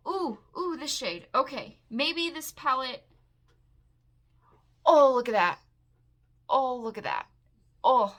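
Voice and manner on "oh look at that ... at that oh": loud bursts of admiration; excited. Real, not acted. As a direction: exclaim in real delight at what you see. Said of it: "Maybe I am excited about this palette".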